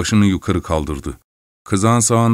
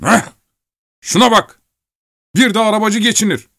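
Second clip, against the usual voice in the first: high, mocking scoff; scoffing miller; 12 semitones higher, 3 dB louder than usual. Miller mocking the driver's skill, scoffing. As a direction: sneer at the so-called coachman.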